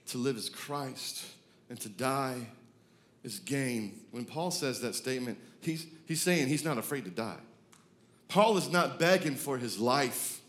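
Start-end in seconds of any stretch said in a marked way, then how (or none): none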